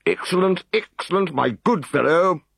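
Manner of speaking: a prefect beaming at his man; jovial. Acted. Prefect Odious Asparagus congratulating the Decurion, jovial. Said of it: "congratulated him jovially".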